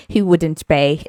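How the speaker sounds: usual speaking voice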